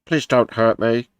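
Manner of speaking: slow, timid pleading voice; a half-hearted Keith impression, slow and begging; weak Keith impression. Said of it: "Not doing my best Keith voice there".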